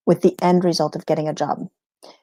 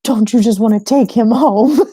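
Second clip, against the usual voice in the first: much higher, gushing voice imitating her son over a baby; 11 semitones higher, 4 dB louder, slower than usual. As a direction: coo like a boy melting over a cute baby. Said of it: "and he'll go".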